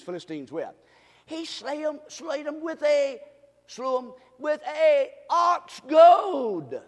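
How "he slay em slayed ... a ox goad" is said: high, halting build to a loud, long finish; preacher stretching out the punch line; emphatic preaching. Real, not acted. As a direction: build up to a drawn-out pulpit shout. Preaches on the verse.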